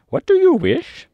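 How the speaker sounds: very high, loud monkey squawk; loud squeaky cartoon monkey; Monkey King, demanding. Monkey King asks Dorothy her wish, high loud squeaky voice.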